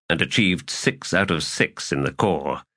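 ordinary delivery, nothing marked

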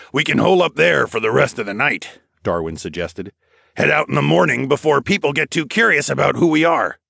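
a practical traveller planning ahead; Darwin character voice. A narrator's reading.

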